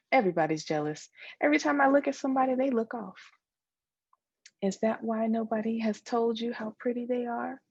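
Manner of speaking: ordinary delivery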